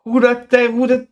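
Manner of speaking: high, excited who-that call; hyped disbelief. Real, not acted. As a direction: blurt it out in amazement. Hyped reaction to a beat.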